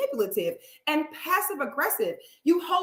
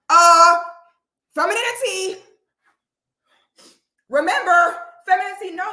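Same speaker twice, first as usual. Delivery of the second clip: mocking mimic; 6 semitones higher, 7 dB louder than usual. Mimicking the woman's taunt.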